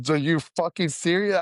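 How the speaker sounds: reactive inner voice. Retold or imitated. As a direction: act out an exasperated gut reaction. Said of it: "I'm that voice".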